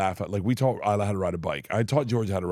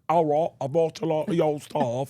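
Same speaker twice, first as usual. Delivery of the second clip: bad British accent; 6 semitones higher than usual. Shown as a demonstration. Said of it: "you are bad at it".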